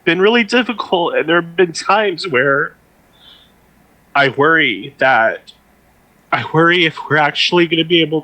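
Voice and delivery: halting, tearful voice breaking off; fighting back tears. Real, not acted. Host voicing worry for the expo, choked up. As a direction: struggle on through real tears. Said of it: "I'm trying not to cry".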